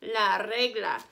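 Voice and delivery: "La regla" slowly, with a trilled R opening "regla"; rolled R. Shown as a demonstration. Spanish teacher demonstrating the R roll, slow trilled "regla". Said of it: "you roll your R".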